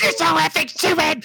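high, angry, exasperated snap; angry stepmom. Retold or imitated. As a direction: mimic a furious stepmom yelling. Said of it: "And she goes".

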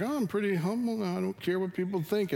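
high, airy pretend modesty; mock humble. Preacher mimicking his own proud thoughts, high-pitched mock humility. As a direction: play at being humble, pitched well up.

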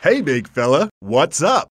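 loud high chirpy greeting; cheery sitcom greeting. Acted. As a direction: sell the catchphrase brightly. Sitcom line delivered on set.

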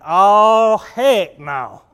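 loud sudden dread, then a flat denial; startled loud groan of dismay; alarmed disbelief. Retold or imitated. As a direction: react to bad news like a gut punch. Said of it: "And I went".

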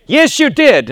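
high, loud flat contradiction; mock cop firmly insisting; officer insisting. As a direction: shut him down, loud and high.

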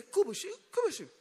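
high-pitched imitation of praying in tongues; mock tongues. Unprepared worker answering auditors, mock speaking in tongues. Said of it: "They want to be speaking in tongues".

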